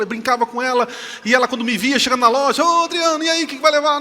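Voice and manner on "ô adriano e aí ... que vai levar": loud, high, jovial hail; playful greeting. Retold or imitated. Shop worker hails him coming into the store, playful greeting. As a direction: call out brightly to a regular customer.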